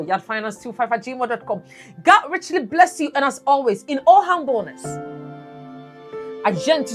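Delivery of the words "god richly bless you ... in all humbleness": ordinary talk, nothing marked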